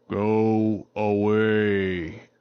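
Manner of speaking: very slow, drawn-out booming warning; tree voice. Acted. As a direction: speak like an ancient living tree. Mysterious voice from tree.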